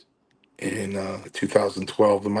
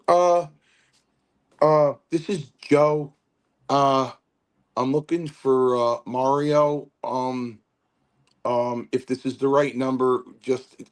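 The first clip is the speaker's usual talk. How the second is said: put-on higher, halting old-man message; imitating Chief's voicemail; 5 semitones higher than usual. Chief leaving a message for Mario, a halting higher imitation. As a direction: leave a hesitant voicemail, searching for words.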